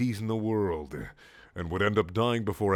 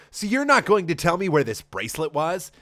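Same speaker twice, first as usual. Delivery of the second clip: higher, demanding put-on voice; mock Sonic voice; 7 semitones higher, 5 dB louder than usual. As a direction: press her hammily. Sonic keeps interrogating.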